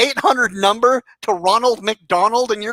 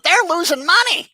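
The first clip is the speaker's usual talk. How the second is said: mocking imitation; 7 semitones higher than usual. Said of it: "you said".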